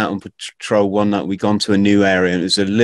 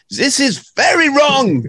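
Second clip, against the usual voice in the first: much higher, slow mock Austrian accent; hammed-up Austrian tourist, pitched way up and drawn out; 3 dB louder, slower than usual. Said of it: "my best Austrian accent there".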